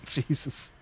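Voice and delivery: giggling through the words; can't stop laughing while speaking; breathless real laughter. Real, not acted. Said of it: "I'm so done".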